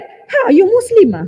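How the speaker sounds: surprised. Retold or imitated. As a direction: jump in pitch, caught off guard. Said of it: "jangan terperanjat".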